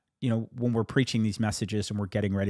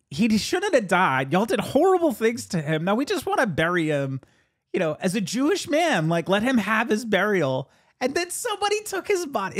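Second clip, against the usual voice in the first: rising indignation; 8 semitones higher, 3 dB louder than usual. Acted. Preaching the disciples' grief, rising.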